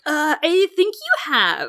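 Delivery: slow, high, put-on elder; lightly voiced old man. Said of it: "I know what voice I was gonna do".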